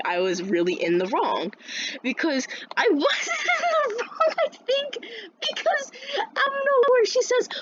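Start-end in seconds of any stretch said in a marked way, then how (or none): 2.0-7.5 very high, squealing voice through laughter; helpless squeaky laugh-talk; breaking up laughing. Real, not acted. Storyteller insisting on being blameless, squealing with laughter.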